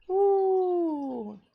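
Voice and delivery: a light high woo of relief; high cheer. Real, not acted. Host cheering as her stream audio comes on, high woo. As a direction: cheer that the sound works.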